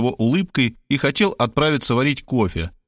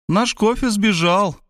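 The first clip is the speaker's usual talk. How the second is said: mocking; 10 semitones higher, slower than usual. A narrator's reading. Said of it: "насмешливо оповестила".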